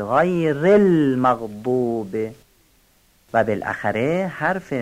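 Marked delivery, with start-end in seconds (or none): 0.0-2.2 المغضوب slow with a clear lam; careful lam. Shown as a demonstration. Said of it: "اینجا لام رو با دقت بگید". (لام المغضوب با دقت)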